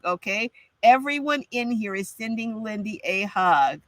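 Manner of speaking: ordinary talk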